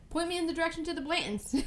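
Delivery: high, fast eager request; eager customer. Retold or imitated. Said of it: "And I was like".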